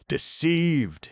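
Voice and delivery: high, loud shout, leaning hard on the second syllable. A narrator's reading. Betrayed husband shouts his outrage to the doctor, loud and high. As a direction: spit it out, stressing the second syllable. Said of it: "he shouted with a strong hissing accentuation of the second syllable".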